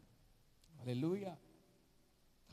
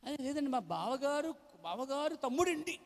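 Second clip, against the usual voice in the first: mock excuse; 12 semitones higher than usual. Preacher mimicking a believer excusing a relative, high and coy.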